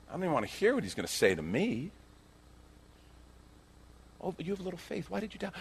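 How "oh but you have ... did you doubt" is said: very soft, high, tender chiding; gentle rebuke. Acted. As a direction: chide him softly, almost under the breath. Gentler rereading of Jesus' rebuke.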